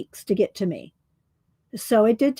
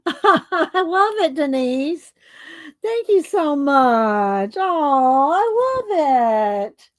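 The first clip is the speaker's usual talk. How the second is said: gushing thanks; 3 semitones higher, much slower than usual. Real, not acted. Thanks the gift giver.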